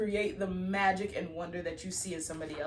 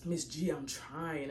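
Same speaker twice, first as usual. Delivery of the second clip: whiny student voice; 2 semitones lower, slower than usual. Retold or imitated. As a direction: drag it out like a tired student. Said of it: "They be like".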